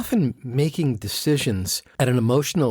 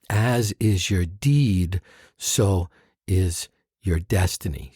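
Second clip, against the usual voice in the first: put-on slow, measured chant of old verse; slow solemn scripture reading; solemn recitation; 4 semitones lower, slower than usual. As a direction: recite it like a sacred text. Reciting ancient Upanishad verse.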